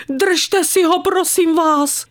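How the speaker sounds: high-pitched, pleading call; frightened cry. A narrator's reading. Frightened lady to the dachshund's owner, crying out in fear. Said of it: "křikla na mě a v hlase měla strach".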